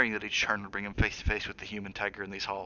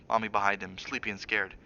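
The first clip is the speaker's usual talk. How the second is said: plain reading voice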